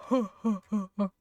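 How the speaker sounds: slow monster chuckle; put-on rumbling ha-ha-ha; deep chuckle. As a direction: chuckle heavily as a huge beast. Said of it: "Deep chuckle".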